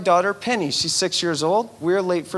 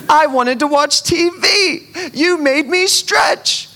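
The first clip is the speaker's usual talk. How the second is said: put-on whiny child; 10 semitones higher, 7 dB louder, slower than usual. Penny's whiny complaints.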